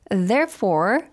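usual speaking voice

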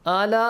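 "ala" with long a, then a glottal catch; final hamza. Shown as a demonstration. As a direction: stretch "ala" and cut it off on a hamza. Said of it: "ends with hamza".

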